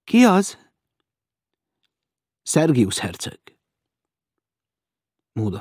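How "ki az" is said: high, hesitant question; uncertain voice. A narrator's reading. Maud answers a knock at the door, uncertain. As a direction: ask unsurely who is there. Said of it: "bizonytalan hangon megszólalt".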